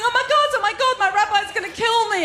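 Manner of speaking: freaking out. Retold or imitated. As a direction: act out a friend freaking out. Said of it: "she freaks out".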